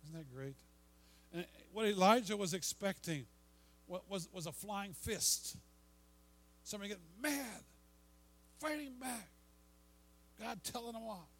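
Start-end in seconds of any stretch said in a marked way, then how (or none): 1.3-11.3 mock anger. Acting out an angry God.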